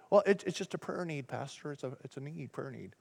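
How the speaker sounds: very soft, confidential mock gossip; sheepish murmur, trailing away; hushed gossip excuse. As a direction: slip it out under your voice, feigning innocence. Gossip passed off as prayer request.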